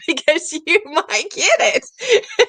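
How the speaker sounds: laughing through the punchline, voice up high and slow. Real, not acted. Warning listeners about wishes, laughing.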